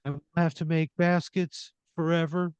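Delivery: put-on high-pitched worried kid voice; tearful child. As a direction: plead like a little boy telling on someone. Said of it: "he's kinda sobbing again".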